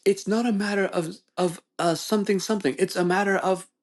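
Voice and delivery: high-pitched mock British debater; British accent. Customer lecturing his friend in debate, fake British accent. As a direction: put on a pompous British accent, high and insistent. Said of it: "one with the British accent's talking".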